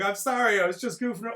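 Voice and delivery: high, loud mock pleading; imitated cowardly apology; pleading whine. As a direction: beg pathetically, hammed up. Acts cowardly Mike's pleading apology.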